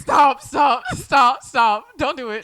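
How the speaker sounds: loud laughing stop, stop; pleading shout. Real, not acted. Friend cutting off the Mamma Mia story with loud laughing stops. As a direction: shout at her to quit the story.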